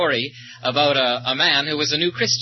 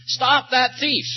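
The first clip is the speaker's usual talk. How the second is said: mock yell; 9 semitones higher, slower than usual. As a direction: call after a runaway, half-shouting. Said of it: "somebody yelled after him".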